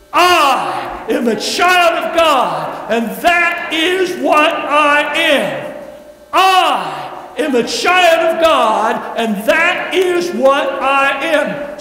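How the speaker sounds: loud, slow, bold declaration. Shown as a demonstration. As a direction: proclaim it like you mean it. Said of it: "We want to say it with boldness".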